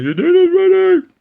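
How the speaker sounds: very high, loud mock screech; hammed-up falsetto mum yell; mum shouting upstairs. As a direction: holler up the stairs like a mum. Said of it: "my mum shouting up the stairs".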